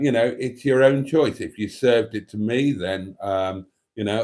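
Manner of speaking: ordinary talk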